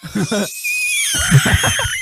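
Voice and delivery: high shrieking laugh. Real, not acted. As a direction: burst into a shrill cackle. Cracking up after the joke.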